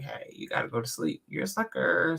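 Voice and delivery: a light whispered self-scolding; hushed self-talk. Retold or imitated. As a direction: mutter it to yourself, drowsy. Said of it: "So I was like".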